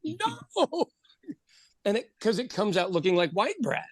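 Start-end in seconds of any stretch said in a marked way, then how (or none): 0.0-0.9 high, pained no no; horrified cry. Retold or imitated. Baker crying out as someone microwaves the dough. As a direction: cry out in mock horror. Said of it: "you're like".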